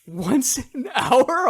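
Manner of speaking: slow, high, laughing disbelief; shocked disbelief. Real, not acted. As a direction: stretch it out in amazement. Shocked echo of reply.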